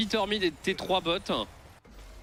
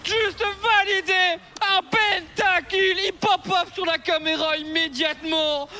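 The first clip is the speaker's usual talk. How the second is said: esports caster; 11 semitones higher, 5 dB louder than usual. Real, not acted. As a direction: belt out the pentakill. Caster screaming a pentakill.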